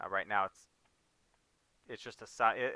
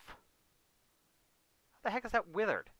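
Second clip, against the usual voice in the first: incredulous; 9 semitones higher, much slower than usual. Real, not acted. Disbelief at withered field.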